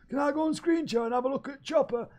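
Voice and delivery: high put-on character voice. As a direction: play the little on-screen guy.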